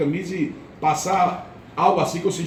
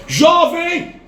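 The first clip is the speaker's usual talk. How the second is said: loud, high call; 11 semitones higher, 5 dB louder, slower than usual. Retold or imitated. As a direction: shout urgently to a dying man. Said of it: "Ela disse".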